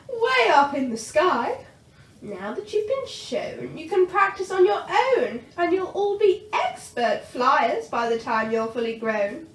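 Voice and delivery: bossy dragon teacher; Madam Dragon. A narrator's reading. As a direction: instruct the class grandly.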